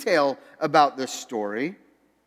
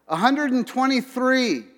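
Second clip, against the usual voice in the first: higher-pitched, hopeful call-out; mock yell; 8 semitones higher than usual. Journalist trying out the prison joke, mock yell. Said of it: "just yelling out numbers".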